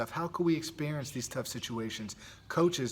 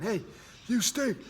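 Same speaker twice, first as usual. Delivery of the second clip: heckler taunt; 9 semitones higher than usual. Retold or imitated. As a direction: jeer it like a sideline heckler. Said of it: "That person on the sideline saying".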